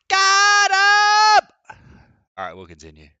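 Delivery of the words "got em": triumphant shout. Real, not acted. Punchline of a nuts joke.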